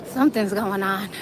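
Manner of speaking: ordinary talk, nothing marked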